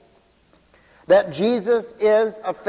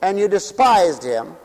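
preaching; 4 semitones higher, 4 dB louder than usual. Acted. Preacher delivers sermon to congregation.

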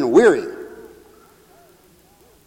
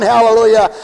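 ordinary talk

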